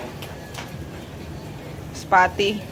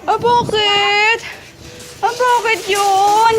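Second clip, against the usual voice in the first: higher, slow cooing question; pet baby talk; 7 semitones higher, much slower than usual. Acted. Owner asking the pets why in slow baby talk. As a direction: coo slowly at the animals.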